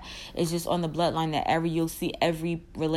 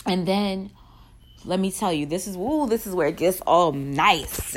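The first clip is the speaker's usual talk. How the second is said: normal talking voice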